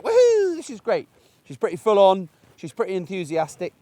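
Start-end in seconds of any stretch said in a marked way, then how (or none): 0.0-1.1 a much higher, loud woohoo that drops into this is great; voicing the excited dog. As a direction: give the puppy's overjoyed thoughts. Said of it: "she's just like".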